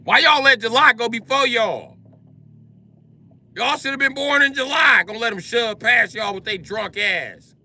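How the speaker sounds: high, loud scolding; scolding shout. Acted. Comic scolding late Leos, high loud shout. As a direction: holler at them for their slowness.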